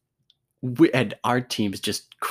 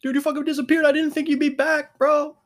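much higher, hyped-up bro voice; excited buddy; 4 dB louder than usual. Retold or imitated. Buddy teasing the tiny player who disappeared under the pile, mock excited. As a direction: rib your friend with loud amazement. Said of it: "his buddy was like".

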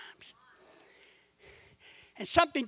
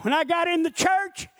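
usual speaking voice

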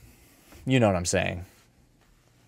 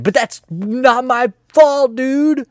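whiny protest; 12 semitones higher, 6 dB louder than usual. Pretend. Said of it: "whiny protest".

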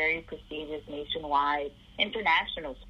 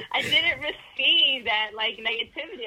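said through laughter, higher and louder; laughing; 8 semitones higher, 5 dB louder than usual. Real, not acted. Laughing while telling.

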